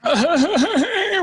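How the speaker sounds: high, loud burst of laughter. Real, not acted. Laughing at a stock question.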